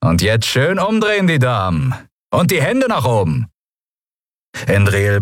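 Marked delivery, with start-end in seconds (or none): 0.0-3.5 higher-pitched, taunting pirate order; cocky pirate command. A narrator's reading. Pirate at gunpoint to two women, mockingly commanding. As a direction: toy with your captives as you order them about.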